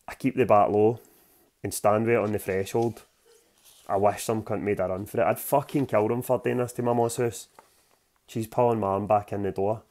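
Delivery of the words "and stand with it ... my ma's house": ordinary delivery, nothing marked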